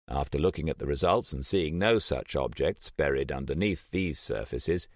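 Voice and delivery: plain reading voice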